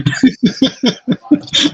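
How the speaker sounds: loud high giggling fit; burst of laughter. Real, not acted. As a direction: crack up laughing. Friends crack up at joke.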